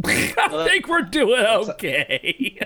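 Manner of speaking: slow, drawn-out playful echo through laughter; laughing echo. Retold or imitated. Host echoes the guest's modest line, laughing mock understatement. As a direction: repeat it back slowly, amused and exaggerated.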